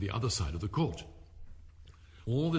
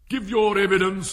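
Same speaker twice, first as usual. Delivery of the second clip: higher and much louder order; king's command; 8 semitones higher, 11 dB louder than usual. Acted. King orders the cook to testify, loud and high. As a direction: command the witness sharply. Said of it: "said the king".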